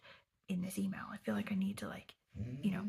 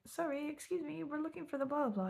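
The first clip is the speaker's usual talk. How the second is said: higher, overly polite "Sorry, excuse me"; polite apologetic asking; 7 semitones higher than usual. Retold or imitated. Retelling asking the caretaker the way, politely. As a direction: sweetly ask the caretaker for directions. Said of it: "we're like".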